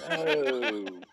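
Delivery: high, laughing groan of dismay; a laughing, mortified oh no. Retold or imitated. Telling teammates how he felt when his pants filled, laughing dismay. Realizing he soiled himself.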